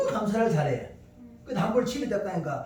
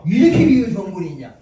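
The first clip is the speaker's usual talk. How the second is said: louder repeat; 10 dB louder than usual. Retold or imitated. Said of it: "이야, 한국에 이렇게".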